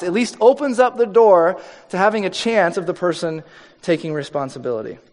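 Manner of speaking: usual speaking voice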